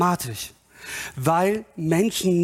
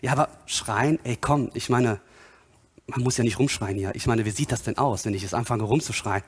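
low, hurried, sceptical mumble; acted low-key protest, pitched down; reluctant objector; 7 semitones lower, faster than usual. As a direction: object like an embarrassed churchgoer.

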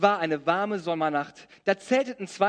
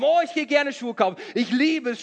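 put-on gushing girl; 4 semitones higher than usual. Voicing the blonde girl gushing.

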